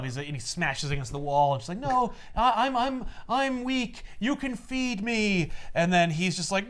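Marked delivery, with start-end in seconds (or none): 1.8-5.5 higher, slower, a put-on feeble voice; mock weak voice. Retelling Magdalene begging Stan to feed her, mock feeble. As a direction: play her as frail and pleading, lightly.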